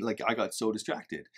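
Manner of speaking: normal talking voice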